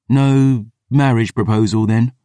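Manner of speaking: usual speaking voice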